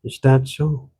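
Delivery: light Irish lilt mixed with Ottawa Valley; old country woman with an Irish-Ontario accent; a hint of an Irish-Valley accent; Irish-tinged rural Ontario accent. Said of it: "She looked him over and scowled".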